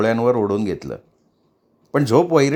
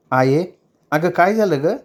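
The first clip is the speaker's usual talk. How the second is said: higher, gently worried question; young woman asking, higher pitched; concerned asking; 6 semitones higher than usual. Acted. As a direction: ask what's wrong, concerned. Bakula asks her mother.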